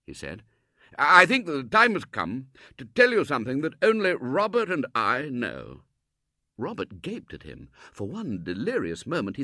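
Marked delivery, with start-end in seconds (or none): 1.0-5.8 high and loud, slowing at the end; solemn Colonel. Acted. Colonel telling Mrs Brown a secret, loud and solemn. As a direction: announce a grave secret.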